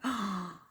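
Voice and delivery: a short shocked gasp; surprised gasp. Shown as a demonstration. Explaining gobsmacked to Americans, a mock shocked gasp. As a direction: gasp as if struck speechless. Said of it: "when you're surprised and you're like".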